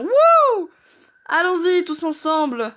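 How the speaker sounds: high whooping cheer; mock cheer. Cheering viewers on into the basement, mock excited. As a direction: whoop with fake enthusiasm.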